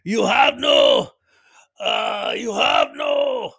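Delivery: high, loud, slow halting shout; mock Japanese player yell. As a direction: bark it out stuck for the word. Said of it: "the Japanese player keeps saying".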